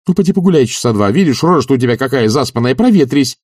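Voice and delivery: high, lively urging; eager coaxing. A narrator's reading. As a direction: talk him briskly out the door. Master coaxing servant to leave.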